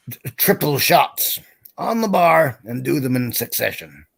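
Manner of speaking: slow, stretched-out Texan drawl; Texan accent. Acted. Texan daring the whole bar to drink, slow Texan drawl. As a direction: throw down the bet like a big Texan.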